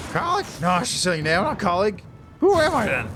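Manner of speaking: lightly voiced drunk.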